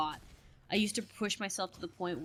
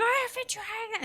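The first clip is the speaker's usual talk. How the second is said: high-pitched mock wail; hammed-up, much higher frazzled outburst; losing it; 14 semitones higher than usual. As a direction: squeal it like a retold meltdown. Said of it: "just lose my mind".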